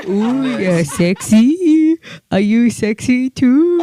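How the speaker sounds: loud, very slow, hammed-up sexy voice; mock seductive. Wife hamming up seducing her husband, loud slow mock-sexy voice. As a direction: overdo the seduction, stretching every word. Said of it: "attempt to seduce your spouse".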